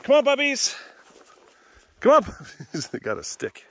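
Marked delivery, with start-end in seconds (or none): 0.0-0.8 high, loud call to the dogs; yelling. Real, not acted. Calling puppies to come along on a walk, yelling high. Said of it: "Sorry for yelling". 2.0-2.9 high, loud call to the dogs; yelling. Real, not acted. Calling puppies to come along on a walk, yelling high. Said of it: "Sorry for yelling".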